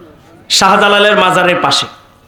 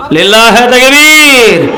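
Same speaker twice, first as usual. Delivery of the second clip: long drawn-out takbir cry; slow, ringing chant-leader's call; rallying slogan call; 4 dB louder, slower than usual. Shown as a demonstration. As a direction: call the crowd to answer, stretching it out. Leads the crowd in a slogan.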